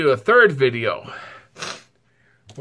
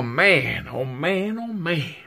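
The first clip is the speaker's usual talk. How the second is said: normal talking voice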